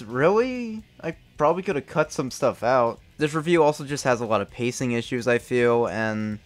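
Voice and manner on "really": incredulous really. Real, not acted. Creator scoffing at the length of his old review, incredulous. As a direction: pitch jumps high in disbelief.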